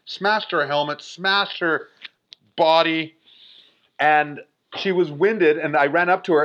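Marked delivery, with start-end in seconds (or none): none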